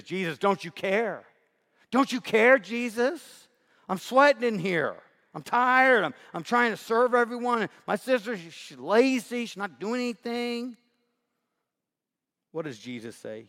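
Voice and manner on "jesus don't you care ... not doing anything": higher and louder, exasperated complaint; irritated Martha. Acted. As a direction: act out the worn-out sister snapping at Jesus. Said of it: "Martha gets a little irritated and says".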